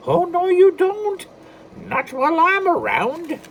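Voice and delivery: high, loud, slow little leprechaun; defiant leprechaun. Acted. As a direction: stand up to them like a tiny leprechaun. Leprechaun Patrick voiced.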